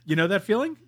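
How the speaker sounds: ordinary talk, nothing marked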